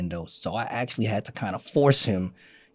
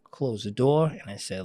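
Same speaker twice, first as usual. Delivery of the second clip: usual speaking voice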